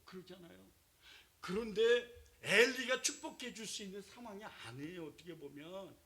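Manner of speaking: ordinary delivery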